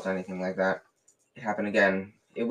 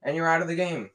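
furious; 6 semitones higher, 4 dB louder than usual. A narrator's reading. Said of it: "said Dean furiously".

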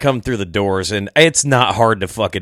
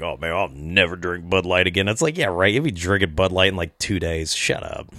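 mock muttered scoff; 6 semitones lower, 6 dB quieter than usual. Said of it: "everyone's like".

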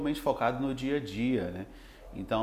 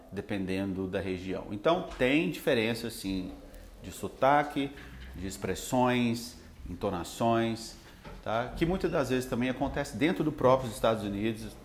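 normal talking voice

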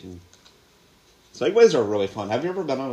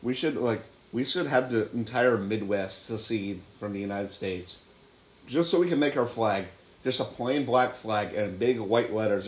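usual speaking voice

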